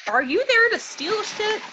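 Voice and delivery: high, loud, drawn-out mock accusation; hammed-up incredulous question. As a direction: challenge him in slow, squeaky disbelief. Acted disbelieving challenge to a character.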